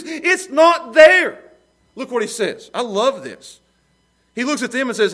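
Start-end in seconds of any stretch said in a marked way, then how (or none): 0.0-1.4 a slow, loud, emphatic cry; preaching shout. Real, not acted. Preacher to his congregation, a slow loud emphatic shout. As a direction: hammer it home to the congregation. Preacher driving the point home.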